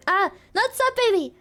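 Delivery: ordinary talk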